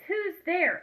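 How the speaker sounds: high, startled little call into the dark; small mouse calling out. Acted. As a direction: call out like a tiny nervous mouse.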